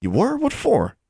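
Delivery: high, rising surprised question. A narrator's reading. Larry asks Alexandra, surprised. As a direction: blurt it in surprise. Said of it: "so surprised".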